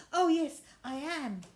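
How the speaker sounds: high, loud doll voice; eager little yes. Acted. Ruby answering Miss Biscuit, high and eager.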